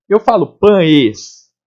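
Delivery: slow, "pães" ending in an I glide; drawn-out demo of pães as pãis; pães with I sound. Shown as a demonstration. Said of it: "Esse E sai com som de I".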